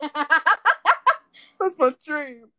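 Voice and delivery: high, drawn-out squeal through laughter; laughing. Real, not acted. Host joking after teasing, laughing hard. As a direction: squeeze the words out while laughing.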